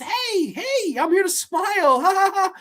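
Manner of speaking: high goofy grinning voice with fake laugh; mock Poe Dameron. Mocking Poe's empty grin, in a high goofy voice. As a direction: ham up a vapid smiling hero. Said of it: "he's just".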